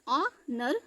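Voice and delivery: "ऑ, नर" in two separate parts, no h; sound-by-sound pronunciation. Shown as a demonstration. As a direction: break "ऑ, नर" after the opening vowel. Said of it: "तो यहाँ का एच silent हो गया".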